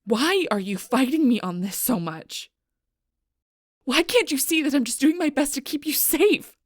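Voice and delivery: loud, rising, frustrated plea; exasperated outburst. Acted. Arguing with someone they want to keep safe, loud and exasperated. Pleading quarrel over keeping them safe.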